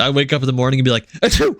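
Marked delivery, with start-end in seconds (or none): 1.2-1.6 fake sneeze. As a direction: burst out a big sneeze. Wakes up sneezing.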